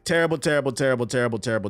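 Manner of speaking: ordinary talk, nothing marked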